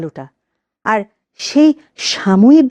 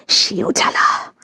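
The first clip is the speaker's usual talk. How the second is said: breathed slow and soft, almost no voice; a whispered, aching ghost; pained whisper; 5 dB quieter than usual. Acted. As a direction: let the burn slip out as a whisper. Ghost repeats her pain.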